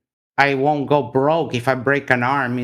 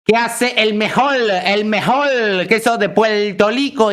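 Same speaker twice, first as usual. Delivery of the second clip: put-on raised, jeering Puerto Rican accent; mock Puerto Rican accent; 5 semitones higher than usual. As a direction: throw his Puerto Rican accent back at him, higher. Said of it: "Este es el mejor acento que hay".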